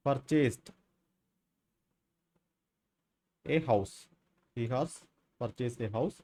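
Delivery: slow word-by-word dictation with long pauses. Shown as a demonstration. Teacher dictating example sentences to students, slow spaced dictation. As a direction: read it out as you write it on the board.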